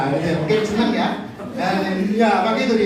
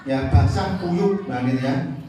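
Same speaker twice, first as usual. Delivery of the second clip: usual speaking voice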